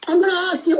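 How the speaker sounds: ordinary talk, nothing marked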